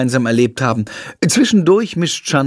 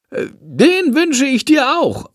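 much higher, slow bat voice; bat reply; 13 semitones higher, slower than usual. Acted. Bat returning the girl's greeting, high and slow. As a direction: answer politely as a tiny bat. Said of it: "antwortet Shandor".

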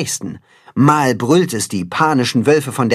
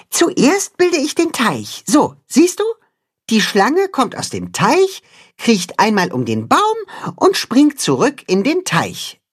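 higher voice, measured step-by-step phrases; patient explaining; 11 semitones higher than usual. A narrator's reading. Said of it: "erklärt Max geduldig".

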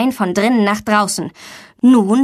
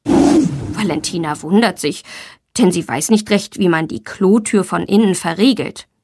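ordinary delivery

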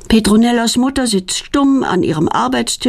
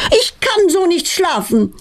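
yelling; 11 semitones higher than usual. A narrator's reading. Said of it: "brüllt Petronellas Mutter laut".

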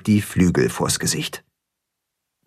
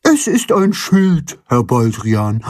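Frau Feudel erklärt; slower than usual. A narrator's reading. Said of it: "erklärt Frau Feudel".

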